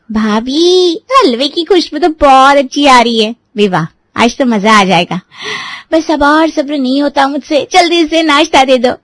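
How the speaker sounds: put-on sweet impatient young girl teasing her bhabhi; a light, high young girl's voice; young sister-in-law. A narrator's reading.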